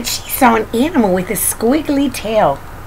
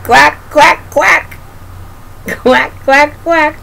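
high, punchy quacks; storybook duck quacking; 6 semitones higher, slower than usual. A narrator's reading. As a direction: quack in quick bursts of three. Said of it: "said the duck".

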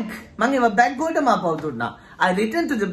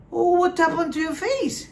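mock shock; 8 semitones higher, slower than usual. Teasing viewers, mock shock.

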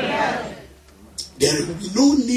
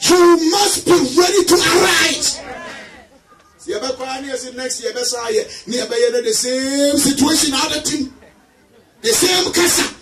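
preaching at full force; 6 semitones higher than usual. Acted. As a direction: hammer each arise home to the church. Preacher exhorting congregation.

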